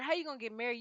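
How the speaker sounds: ordinary talk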